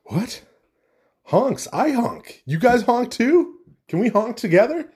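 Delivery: high, slow, eager duckling voice; squeaky, hopeful cartoon duckling; excited duckling. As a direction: beg to join in, delighted. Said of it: "the little duckling is like".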